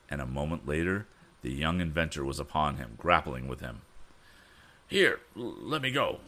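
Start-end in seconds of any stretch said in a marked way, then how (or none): 4.9-6.2 higher cry, stumbling start; cried out. A narrator's reading. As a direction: squirm and cry to be let go. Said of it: "cried a voice".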